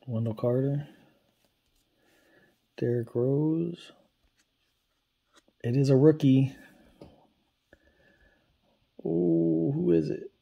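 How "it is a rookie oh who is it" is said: louder, then higher, eager; rising thrill at a last-pack hit; eager call to see who it is; excited reveal. Real, not acted.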